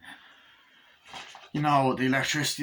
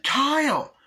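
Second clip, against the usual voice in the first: very high, loud call of a name; mock cry out; 6 dB louder than usual. Andy sees Kyle hit, shocked high yell. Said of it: "And Andy's like".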